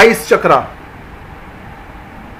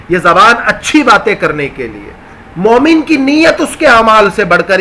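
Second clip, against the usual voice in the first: ordinary delivery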